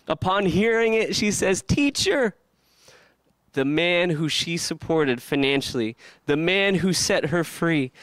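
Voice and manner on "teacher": high-pitched cry of recognition; acted exclamation, voice leaping up; astonished recognition. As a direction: call out in sudden amazed recognition. Mary recognizes Jesus' voice.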